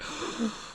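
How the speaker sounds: high, whispered startled gasp; a quick in-breath of shock; sharp gasp. Retold or imitated. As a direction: gasp as you slam back into your body. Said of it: "smack into my body".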